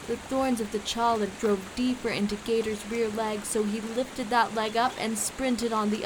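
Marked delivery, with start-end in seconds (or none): none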